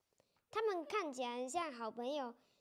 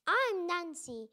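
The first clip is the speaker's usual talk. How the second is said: speaking slowly; 3 dB louder than usual. Acted. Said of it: "I speak slowly so he will understand".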